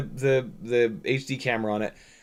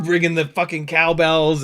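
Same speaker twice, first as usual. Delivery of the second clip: ordinary talk, nothing marked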